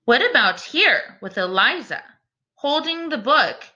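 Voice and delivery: ordinary talk, nothing marked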